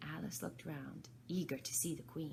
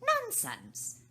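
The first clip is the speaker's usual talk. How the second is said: much higher, firm loud retort; loud and decided; 9 dB louder than usual. Acted. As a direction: shut her down flat. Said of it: "very loudly and decidedly".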